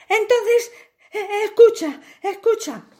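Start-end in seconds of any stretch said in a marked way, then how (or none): none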